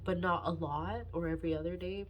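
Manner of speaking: ordinary delivery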